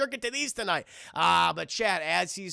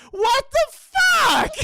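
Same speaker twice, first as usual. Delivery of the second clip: much higher, louder, slow stretched outrage; shocked mock shout; 9 dB louder, much slower than usual. Peter watching Harry steal his moment, shocked slow shout. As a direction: scream it out in disbelief. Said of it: "And Peter's like".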